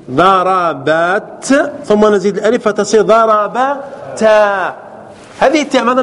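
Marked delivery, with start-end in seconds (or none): 0.1-1.7 ضربت stretched very slowly, rising; slow drawn-out demo. Shown as a demonstration. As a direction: drag the word out for contrast. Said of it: "teacher drawing out verb form". (مد ضربت ببطء شديد)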